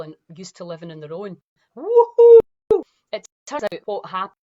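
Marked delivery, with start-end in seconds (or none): 1.8-2.8 high, loud mock whoop; mock cheer. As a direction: whoop it like a party crowd. Mocking community excitement.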